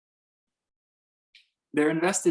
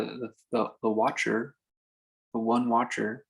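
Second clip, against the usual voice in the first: ordinary delivery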